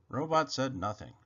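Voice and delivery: ordinary delivery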